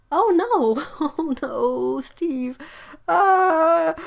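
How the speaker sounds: high, loud wail of real dismay; upset. Real, not acted. Reading the sad part of a kitten story, upset. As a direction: cry out in sympathy, voice pushed up. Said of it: "I got to the sad part".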